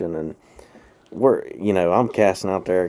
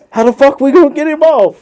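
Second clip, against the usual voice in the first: exasperated friend; 8 dB louder than usual. Retold or imitated. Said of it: "he said".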